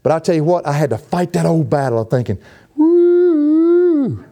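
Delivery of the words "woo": high loud self-satisfied woo; high, loud gleeful woo; proud whoop. Retold or imitated. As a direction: whoop at your own success. Retelling his own proud thought.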